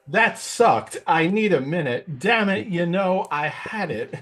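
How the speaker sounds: high, annoyed Walken-ish mutter at himself; a lightly voiced Walken cursing a flubbed take; Walken breaking character. Walken frustrated after botching his audition line. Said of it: "his Christopher Walken was pretty hilarious".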